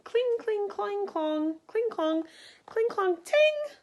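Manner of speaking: high ringing cling clang of metal; clanging armor sounds. Acted. Narrator voicing the knights' armor, high clanging sounds. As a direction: play the armor clanking, high and bright. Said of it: "they're saying".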